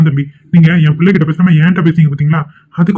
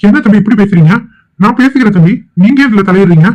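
higher-pitched indignant rebuke; raised pitch, offended; angry; 4 semitones higher than usual. Acted. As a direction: tell him off for interfering. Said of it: "இவர்கிட்ட கோவப்படுறீங்க".

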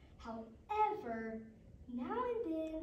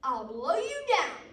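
loud, raised wolf threat; big storybook wolf menace; wolf's threat; 4 semitones higher, 10 dB louder than usual. Acted. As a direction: threaten loudly, swooping up in pitch. Wolf threatens third pig.

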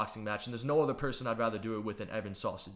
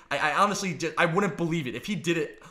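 ordinary talk

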